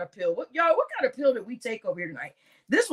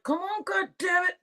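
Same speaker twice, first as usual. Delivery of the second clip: high, strained complaining voice; 7 semitones higher, 5 dB quieter than usual. Retold or imitated. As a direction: groan it like you can't keep your eyes open. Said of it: "It's like".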